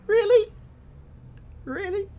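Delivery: squeaky high mock "really?"; mock disbelief. Real, not acted. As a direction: jump way up in pitch, playing incredulous. Reacting to chat comment.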